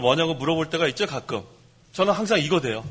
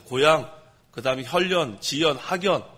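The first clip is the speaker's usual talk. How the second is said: ordinary talk, nothing marked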